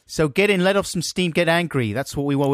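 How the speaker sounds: high, urgent call to action; mock rabble-rousing host. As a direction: whip the callers up. Urging callers to get angry.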